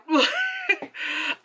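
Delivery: high-pitched burst of laughter. Real, not acted. Breaks into laughter.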